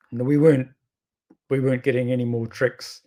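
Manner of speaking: ordinary delivery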